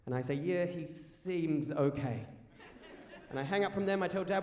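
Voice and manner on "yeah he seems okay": slow, drawn-out uncertain answer; doubtful, dragging reply; hesitant. Retold or imitated. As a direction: stretch SEEMS as if unsure. Hesitant answer to emergency operator.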